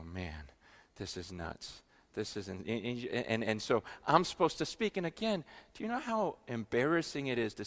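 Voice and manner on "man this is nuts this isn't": low hushed murmur, overwhelmed; hushed awe. Retold or imitated. Visitor at a prayer meeting, hushed and awestruck. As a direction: say it under your breath, stunned by what you hear.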